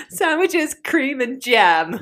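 laughing, drawn-out listing. Acted. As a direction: laugh through the words. Mocking partner's choice.

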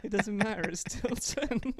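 giggling, stretched-out delivery; laughing. Real, not acted. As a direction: repeat the punchline while cracking up. Repeating own punchline while laughing.

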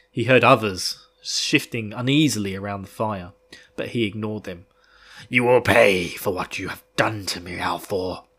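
steady, dramatic audiobook read; story narration. A narrator's reading. Host reads Fane's chapter aloud, dramatic narration.

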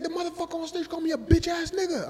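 high whiny complaint; mock outraged drunk. Heckler complains about the man on stage, high mock whine. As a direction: act the drunk's grievance.

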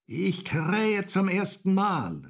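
high, slow, loud crowing call; rooster calling down. A narrator's reading. Golden rooster on the bell tower, calling down. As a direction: crow it out slowly from on high. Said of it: "rief der goldene Hahn hinunter".

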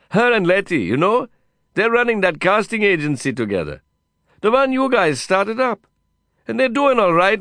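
put-on high-pitched Indian-accented enthusiasm; exuberant Indian cowboy. Cowboy friend explaining his new business, exuberant and high. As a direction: rattle on proudly in a high voice. Voices Vikram in the dialogue.

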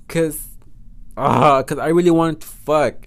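loud exasperated venting; groan, then complain loudly; pissed off. Retold or imitated. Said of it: "I was so pissed off".

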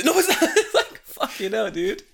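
high, loud, through real laughter; laughing disbelief. Real, not acted. Man rejecting the gossipers' absurd excuse, laughing loudly. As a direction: burst out protesting while cracking up.

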